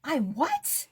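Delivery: high squeak of disbelief; a retold double take, pitched high; shocked disbelief. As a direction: jump way up in pitch, stunned.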